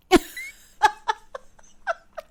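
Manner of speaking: loud, high burst of laughter; cracking up at her own joke; big laugh. Real, not acted. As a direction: bursts out laughing at the interview story.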